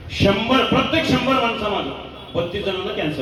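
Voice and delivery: preaching at full force. Acted. Kirtankar warning the audience about cancer, forceful preaching. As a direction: hammer home the figure to the crowd.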